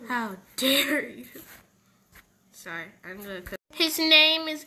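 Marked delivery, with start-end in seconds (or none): 0.1-1.2 mock offended. Mock offence after the joke.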